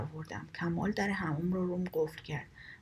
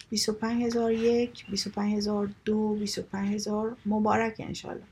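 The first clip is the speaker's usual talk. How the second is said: auctioneer call; 4 dB louder than usual. Acted. Kamal the auctioneer calling bids to the crowd, loud sing-song call. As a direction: call out the bids to the whole room.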